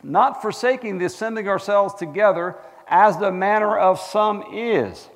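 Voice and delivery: loud, high pulpit reading; declaimed Bible verse, slowing at the close; scripture reading. Acted. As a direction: proclaim the verse to the whole congregation. Reads scripture aloud.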